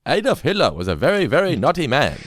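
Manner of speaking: lightly voiced old newsreel announcer; news reporter voice. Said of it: "my favourite [name] voice of all".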